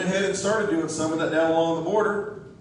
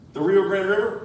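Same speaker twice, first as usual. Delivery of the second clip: ordinary talk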